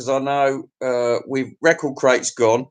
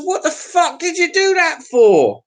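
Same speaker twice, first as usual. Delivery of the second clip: high, loud outraged yell; husband yelling at wife over sold records, outraged; outraged retort; 12 semitones higher, 3 dB louder than usual. Retold or imitated. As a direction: fire it back at her in disbelief. Retold row with his wife.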